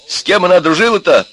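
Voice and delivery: high, loud angry demand. A narrator's reading. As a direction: raise your voice to interrogate. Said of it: "Опять повысил он голос". (повышенный голос, сердитый вопрос)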